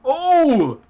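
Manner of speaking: surprised gasp. Real, not acted. Crash seen live, commentator gasps.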